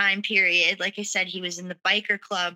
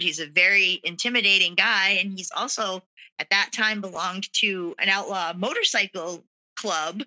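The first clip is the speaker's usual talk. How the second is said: ordinary talk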